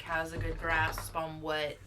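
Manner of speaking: ordinary delivery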